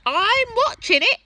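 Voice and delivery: very high, loud granny screech; shouting Grandma. A narrator's reading. Grandma shouting down to George. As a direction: screech it like a proud old woman. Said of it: "the old girl shouted back".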